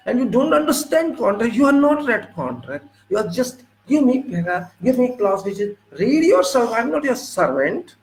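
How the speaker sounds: high-pitched indignant scolding, pitch up all through; retold angry rebuke. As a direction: scold him like you're fed up with doing his job. Retold heated reply to arbitrator.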